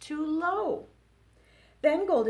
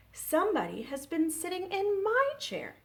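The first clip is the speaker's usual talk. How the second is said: higher mama bear voice; 9 semitones higher than usual. Acted. Librarian reading to kids, Mama Bear higher.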